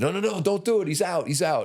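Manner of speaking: high, loud urgent shout to stop; bystander shouting. Retold or imitated. Bystander yells to stop the knockout punch, high and loud. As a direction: yell at him to stop, voice jumping up. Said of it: "I hear this other dude".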